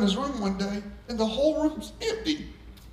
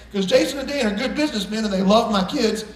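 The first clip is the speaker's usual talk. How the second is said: usual speaking voice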